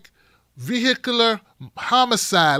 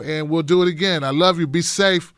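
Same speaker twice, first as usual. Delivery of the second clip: ordinary talk, nothing marked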